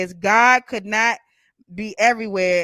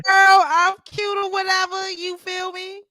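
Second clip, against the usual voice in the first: sassy; 11 semitones higher, 6 dB louder than usual. Real, not acted. Sassy reply to a compliment.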